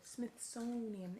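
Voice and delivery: Smithsonian spelled out slowly, syllable by syllable; careful pronunciation. Shown as a demonstration. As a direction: stretch out Smithsonian clearly. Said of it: "Is that a Z or an S?".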